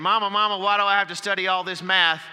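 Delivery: a hint of a kid begging his mama; whiny kid. Little kid complaining to his mother about math, lightly whiny.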